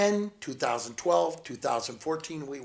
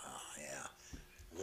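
hushed awe; 13 semitones lower, 22 dB quieter than usual. Retold or imitated. Crowd murmuring in awe.

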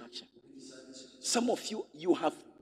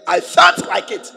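much higher and louder, stress on felt; emphatic shout; 13 semitones higher, 11 dB louder than usual. Retold or imitated. Pastor insisting to congregation, loud shout. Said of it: "I said".

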